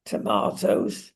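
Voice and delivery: normal talking voice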